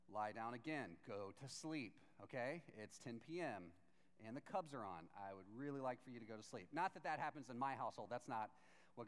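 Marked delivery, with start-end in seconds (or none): 0.1-6.7 low, restrained parental plea; weary bedtime parent. Acted. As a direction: send a kid back to bed, voice kept down. Eli voiced as tired bedtime parent.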